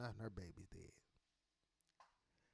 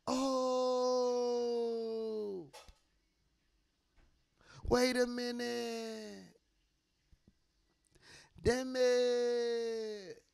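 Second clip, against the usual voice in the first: dismayed realization; 7 semitones higher, 3 dB louder, much slower than usual. Retold or imitated. Realizing those ancestors weren't his.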